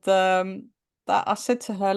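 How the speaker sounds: ordinary talk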